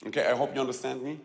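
ordinary talk, nothing marked

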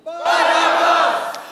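crowd shout. Pretend. Preacher as the crowd, screaming for Barabbas. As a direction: scream the name as the mob. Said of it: "you must scream".

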